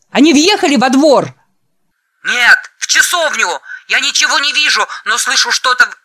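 high, loud frantic yelling; panicked shouting. Acted. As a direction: yell it in sheer panic. Panicked shouts over intercom. (панический крик)

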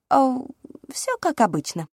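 high airy princess voice; cartoon princess. A narrator's reading. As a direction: toss it off like a pampered princess. Princess act.

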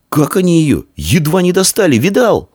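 very high, thrilled exclamation; high-voiced boyish excitement; excited boy. A narrator's reading. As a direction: marvel to a friend, pitched high. Excited boy talks during the chase.

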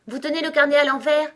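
high, loud, quick exclamation; startled exclamation. A narrator's reading. Alice sees the notebook upside down, exclaiming. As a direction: burst out on spotting the mistake. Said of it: "s'exclama Alice".

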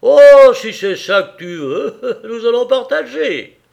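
loud, high jeering with a laugh; mocking. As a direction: taunt her, laughing at her fear. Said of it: "d'un air goguenard".

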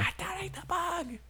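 unvoiced whispered student aside. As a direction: whisper it to a friend.

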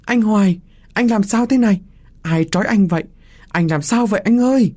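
high, alarmed calling; high-pitched, frantic questions; distressed cry. A narrator's reading. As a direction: cry out in shock for a bound lover. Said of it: "Cô la lên".

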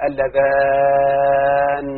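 اللذان said very slowly, its doubled nun held long and heavy. Shown as a demonstration. Teacher shows pupils the doubled nun in اللذان, slow and heavy. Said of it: "بالشد تعوض عن الياء المحذوفة".

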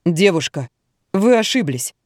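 firm man correcting a stranger. A narrator's reading. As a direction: state it firmly, without fuss. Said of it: "твёрдо заявил мужчина". (твёрдо, по-мужски)

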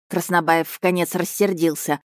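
ordinary delivery

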